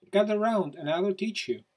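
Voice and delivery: high and loud, gathering the flock; eager calling out. Acted. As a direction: call them in, bright and eager. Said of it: "said Blue Penguin".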